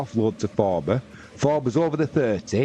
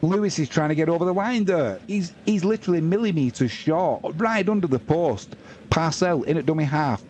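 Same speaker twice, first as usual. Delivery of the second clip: raised, urgent match commentary; live play-by-play; 6 semitones higher than usual. Acted. Commentator calling a goal-line raid, urgent play-by-play. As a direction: call the near-try as it happens.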